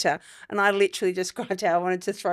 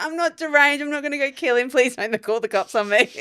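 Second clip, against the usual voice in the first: higher, hammed-up pleading; pleading backpedal; 4 semitones higher than usual. Mum pleading with nurses, mock panicked. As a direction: beg them not to take it seriously. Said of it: "I was like".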